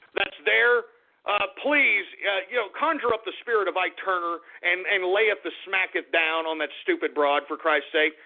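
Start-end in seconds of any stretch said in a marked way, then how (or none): none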